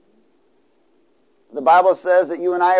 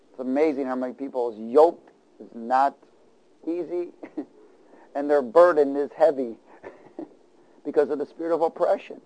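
ordinary delivery

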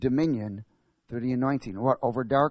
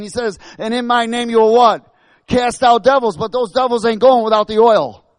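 loud, high, driving preacher; fiery preaching; 9 semitones higher, 9 dB louder than usual. Acted. Pastor rousing the church about the oil war, loud. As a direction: preach it hard to the congregation.

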